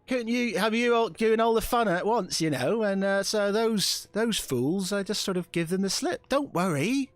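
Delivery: a sly Cockney stowaway bragging; Cockney girl. Acted. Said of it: "sort of like a Cockney type voice".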